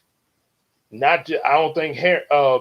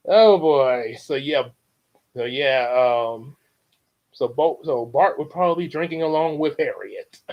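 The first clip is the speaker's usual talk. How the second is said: plain reading voice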